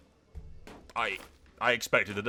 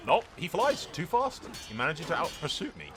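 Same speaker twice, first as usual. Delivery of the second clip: high-pitched exasperated disbelief; incredulous, raised gamer outburst; 6 semitones higher than usual. Real, not acted. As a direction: gripe in disbelief as the enemy gets away. Exasperated reaction to escaping enemy.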